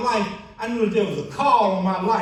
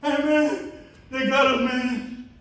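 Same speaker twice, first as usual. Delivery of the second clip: imitating a crying friend; 7 semitones higher, much slower than usual. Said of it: "he was crying".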